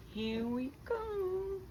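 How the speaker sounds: dreamy high drawn-out here we go; here we go, slowed and high. Real, not acted. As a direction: savor the wait, stretch it out high. About to take first bite.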